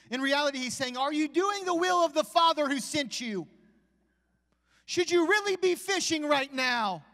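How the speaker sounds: high, loud preacher's cry; preaching at full force. Acted. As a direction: shout it from the pulpit. Preacher builds to a shout.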